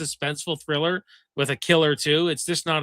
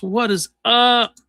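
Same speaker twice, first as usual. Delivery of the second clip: hyped greeting; 8 semitones higher, 7 dB louder, slower than usual. Retold or imitated. Greeting read from chat.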